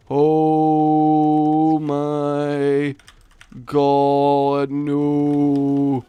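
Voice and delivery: loud, long, stretched-out lament; mock-despairing drawn-out groan; drawn-out dismay. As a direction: intone each word like a slow wail. Drawn-out dismay at taking damage.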